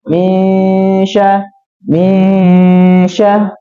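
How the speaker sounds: misya drawn out very slowly and flat; flat misya demo. Shown as a demonstration. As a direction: stretch misya with no nasal hum. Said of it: "Jadi terlihat datar".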